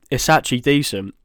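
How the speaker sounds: ordinary delivery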